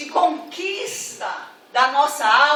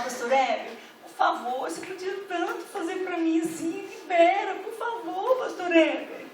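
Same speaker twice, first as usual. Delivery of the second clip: mock whimpering plea, higher and softer; whiny pleading; 3 semitones higher, 8 dB quieter than usual. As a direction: whine like a spoiled child begging. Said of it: "a falar chorando pra você conquistar as coisas". (súplica manhosa, chorosa e fingida)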